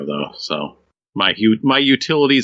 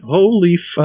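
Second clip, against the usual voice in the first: mock exclamation; 7 semitones higher, 4 dB louder, slower than usual. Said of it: "People with the line went".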